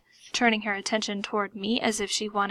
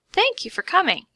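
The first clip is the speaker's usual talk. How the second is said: higher, strained greeting; crying out; 9 semitones higher, 4 dB louder than usual. A narrator's reading. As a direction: blurt it as if pricked by pins. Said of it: "she cried out".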